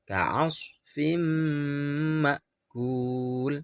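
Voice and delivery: ka'asfim ma'kul with the m merged and hummed two beats; idgham bi ghunnah. Shown as a demonstration. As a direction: recite ka'asfim ma'kul with a long nasal hum. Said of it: "Harus mendengung lamanya dua ketuk". (ka'asfim ma'kul berdengung dua ketuk)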